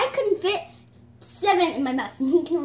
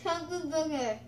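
cheeks stuffed full; 7 dB quieter than usual. Real, not acted. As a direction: mouth crammed with marshmallows. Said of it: "Is that fly?".

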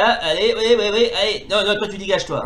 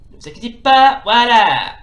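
loud reassurance; 3 semitones higher, 9 dB louder than usual. Real, not acted. Respawning after death.

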